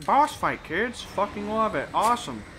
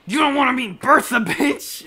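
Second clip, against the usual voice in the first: fast, louder snarl with a laugh at the end; mock threatening yell; 5 semitones higher, 6 dB louder than usual. Threatening a bully over lunch money, a loud mock yell. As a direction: bark it like a cartoon tough guy threat. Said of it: "And I'm like".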